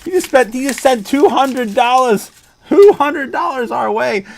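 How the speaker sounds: high, loud, thrilled telling; can barely believe it; real excitement. Real, not acted. Streamer telling chat about the record donation, thrilled.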